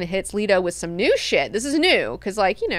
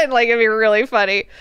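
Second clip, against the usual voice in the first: normal talking voice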